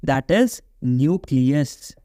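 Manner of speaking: "nucleus" drawn out very slowly for dictation. Shown as a demonstration. Teacher dictating a term to students, very slow. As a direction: spell "that is nucleus" out slowly so students can write it. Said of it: "पढ़ो".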